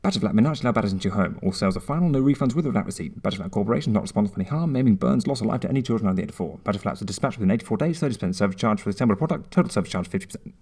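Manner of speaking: fast rattled-off legal disclaimer; advert small print. Acted. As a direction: rush the terms like end-of-ad small print.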